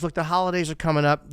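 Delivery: ordinary talk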